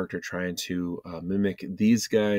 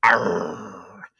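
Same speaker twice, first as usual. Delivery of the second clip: pirate growl; 6 dB louder than usual. Shown as a demonstration. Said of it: "Do pirates say".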